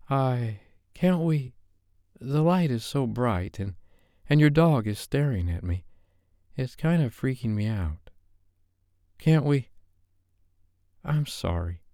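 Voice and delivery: a shy woman begging, tripping over her words; nervous Sophie. Acted. Flustered Sophie asking Dave to turn off the lights.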